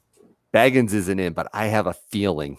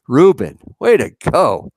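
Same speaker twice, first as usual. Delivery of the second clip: louder, higher, hearty shout-out; congratulatory cheer; 6 semitones higher, 8 dB louder than usual. Real, not acted. Host congratulating a viewer in the chat, congratulatory cheer. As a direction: cheer him on with a punchy rise.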